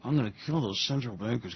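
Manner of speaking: low, soft menacing mock Putin; a quiet threat as Putin. Putin voiced threatening his central bankers, mock menace. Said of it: "Putin goes".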